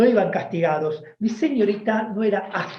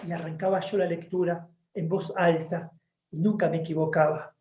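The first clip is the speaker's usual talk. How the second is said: ordinary delivery, nothing marked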